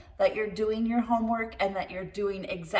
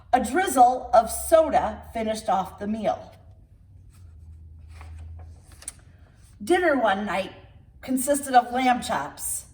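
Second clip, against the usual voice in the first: put-on lively read-aloud storytelling for children; storybook reading; 3 semitones higher, 3 dB louder than usual. Reader telling children a tall tale, lively storybook narration. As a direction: read it like bedtime story time.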